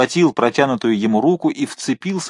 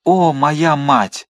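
very slow, louder cry full of hatred; hateful exclamation; 4 semitones higher, 4 dB louder, much slower than usual. Acted. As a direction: drag it out with burning hatred. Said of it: "воскликнул Мордаунт с горящим взглядом". (медленный, громкий возглас, полный ненависти)

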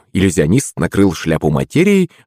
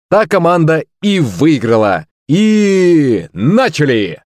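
high, lively game-show presenter; excited stage host; showman host; 8 semitones higher than usual. Acted.

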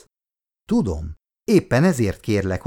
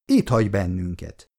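usual speaking voice

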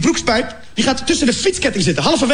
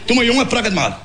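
father's voice. Retold or imitated. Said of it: "Dat zei hij ook tegen mij".

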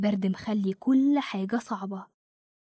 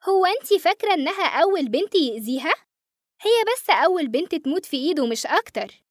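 put-on higher, sly little girl voice; a teasing ghost child who knows more; 8 semitones higher, 4 dB louder than usual. Voices Nancy, creepy ghost girl.

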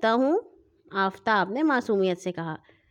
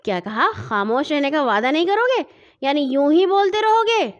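rising angry scolding, high and loud at the end; sister glaring; 5 semitones higher, 5 dB louder than usual. A narrator's reading. Big sister scolding the chattering boy, rising angry. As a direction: scold him, building to a sharp high peak. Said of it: "باجی نے آنکھیں نکالی".

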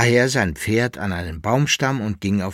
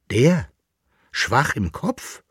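astonished question, "Kopf" drawn out and lifted; incredulous exclamation; 3 semitones higher, slower than usual. A narrator's reading. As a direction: echo it back in disbelief, rising. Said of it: "rief der Besucher".

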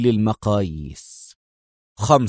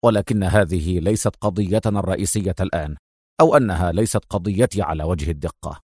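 firm; 6 semitones lower, 3 dB quieter than usual. Acted. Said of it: "مستطردا في حزم".

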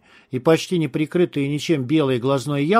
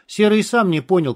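ordinary delivery

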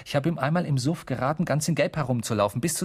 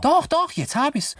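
high, loud burst of eureka; excited, squealed "got it"; sudden realisation; 14 semitones higher, 4 dB louder than usual. Acted. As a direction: leap up in pitch as it comes back. Suddenly remembers the answer.

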